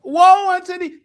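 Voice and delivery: high, loud prophet's curse; mock biblical prophet. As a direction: cry woe like an old prophet. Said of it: "what he called".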